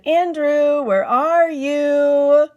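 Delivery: calling out. Acted. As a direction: call up to him in the tree. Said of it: "and then she said".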